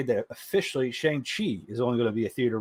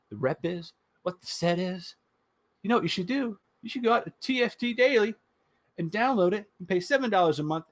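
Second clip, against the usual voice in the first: upbeat pitchman voice, higher, pushing; sponsor ad read; 5 semitones higher than usual. Acted. Host reading sponsor spot for listeners, hyped ad read. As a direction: sell it like an infomercial.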